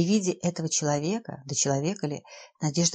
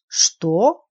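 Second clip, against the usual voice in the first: indignant exclamation; 7 dB louder than usual. Acted. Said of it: "воскликнул Чибиков".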